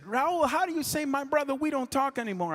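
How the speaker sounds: high, raspy-free impression of [name] on the phone. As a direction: imitate [name] calling late at night, voice up high. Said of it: "he said".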